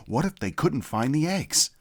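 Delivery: ordinary delivery, nothing marked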